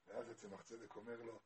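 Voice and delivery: ordinary talk, nothing marked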